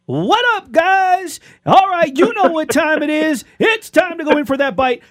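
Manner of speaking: high-pitched, hyped-up grilling video host; Bama Grill Master. Acted. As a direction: impersonate an online grill guy's catchphrase intro. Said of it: "that's a very good impression".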